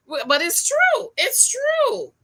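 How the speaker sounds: high, loud "true"; emphatic insistence. Real, not acted. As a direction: insist it's true, pitch jumping up.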